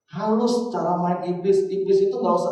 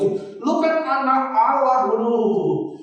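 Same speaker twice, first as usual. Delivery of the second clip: taunting devil; 7 semitones higher, slower than usual. Retold or imitated. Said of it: "iblis kan bisa ngomong".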